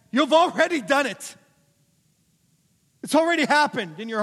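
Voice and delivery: high, loud, driving sermon voice; full-force preaching. Real, not acted. Preacher hammering the Pharisees' outward rules, full-force preaching. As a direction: preach it loudly and insistently.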